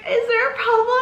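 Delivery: high squeaky question, close to a laugh; retold sheepish squeak; nervous inquiry. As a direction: ask the cop in a tiny high voice. Nervously addressing the cop.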